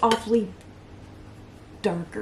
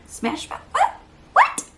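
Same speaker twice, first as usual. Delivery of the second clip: mock disbelief; 4 dB louder than usual. Hearing the powder changed brands, a disbelieving shriek. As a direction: act stunned at the news. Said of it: "And I'm like".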